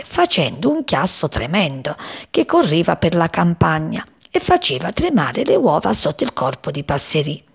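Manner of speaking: usual speaking voice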